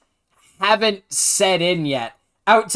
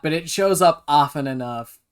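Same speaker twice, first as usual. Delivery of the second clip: usual speaking voice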